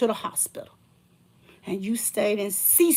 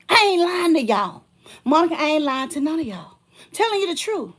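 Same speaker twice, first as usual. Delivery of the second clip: higher and louder, pleading truth; insist, voice raised high; insistent outcry; 6 semitones higher, 6 dB louder than usual. Real, not acted. Swearing to viewers she tells the truth, raised voice.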